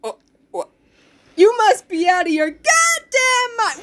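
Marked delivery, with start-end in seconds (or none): 1.4-3.8 slow, loud mock outrage; outraged shout. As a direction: yell it as a hammed-up disbelieving king. Oedipus voiced, outraged.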